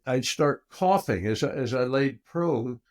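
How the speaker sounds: ordinary delivery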